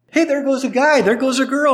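put-on high, bright kid calling out; a little kid spotting people, high voice; simple child. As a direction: point and name like a little child. Little kids naming guy, girl.

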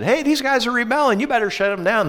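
high, whiny complaining accuser; tattling accuser voice. Retold or imitated. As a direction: squeal it like a tattletale. Opponents write to the king.

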